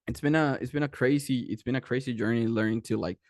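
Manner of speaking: usual speaking voice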